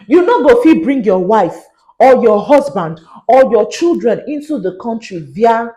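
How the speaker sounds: loud, emphatic Nigerian Pidgin. Shown as a demonstration. Vlogger breaking the rule down for Nigerians, Nigerian Pidgin. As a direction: spell it out forcefully in Nigerian Pidgin. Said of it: "local dialect. For those who are Nigerians".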